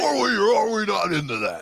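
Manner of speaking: loud announcer voice, laughing; laughing wrestler voice. Pretend. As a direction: hype call through a laugh. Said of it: "I was like a wrestler for a minute".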